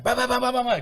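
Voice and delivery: high mock knocking sounds; imitated banging. Kid remembering dad pounding on the door, mock banging. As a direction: bang out the knocks with your voice.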